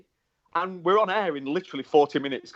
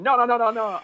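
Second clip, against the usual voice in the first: high, rapid-fire Italian-style refusal; badly faked Italian accent, pitched up; attempted Italian accent; 7 semitones higher than usual. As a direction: imitate an Italian official refusing. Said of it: "I can't do the Italian accent".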